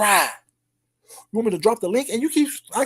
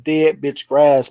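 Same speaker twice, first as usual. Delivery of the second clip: slow naming; 7 semitones lower, slower than usual. Acted. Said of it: "That's your name from this point on".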